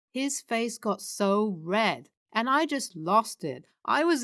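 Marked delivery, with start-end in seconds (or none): none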